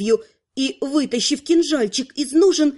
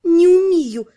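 put-on higher, louder, upset girl; tearful outburst; 4 semitones higher, 5 dB louder, slower than usual. A narrator's reading. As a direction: blurt it out through tears. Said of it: "прошипела девушка сквозь слёзы".

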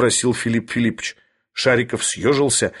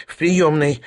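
higher, meek little answer; resigned character voice, pitched up; submissive; 4 semitones higher, slower than usual. A narrator's reading. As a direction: give in obediently. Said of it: "покорно ответил Шариков".

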